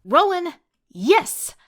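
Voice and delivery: high loud victory shout; triumphant cheer. Acted.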